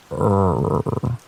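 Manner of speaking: imitated low animal groan; throaty creature growl, held briefly; low sonorous growl. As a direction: growl a low, rolling rrrr. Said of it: "This was a low and more sonorous sound, kind of like a".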